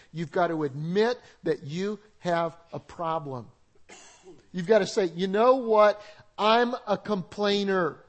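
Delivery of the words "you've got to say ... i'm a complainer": loud, emphatic admission; loud confession. Shown as a demonstration. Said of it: "Would you say that together with me".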